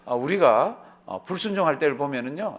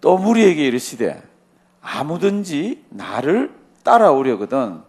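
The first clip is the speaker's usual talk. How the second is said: slow, louder, drawn-out reading of the verse; solemn scripture reading; 6 dB louder, slower than usual. Shown as a demonstration. Preacher reads the verse aloud to the congregation, slow and solemn. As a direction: intone the verse slowly like holy writ. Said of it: "성경 말씀 보면 이렇게 되어 있습니다".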